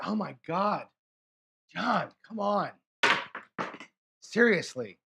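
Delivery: loud, exasperated cry at the dice; frustrated outburst. Real, not acted. A dice shooter at the craps table blurts out a loud, frustrated cry. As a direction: let the frustration burst out at a bad roll. Angry at a bad dice roll.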